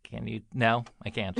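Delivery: usual speaking voice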